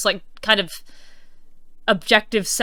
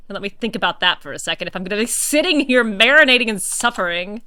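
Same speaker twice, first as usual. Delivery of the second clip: normal talking voice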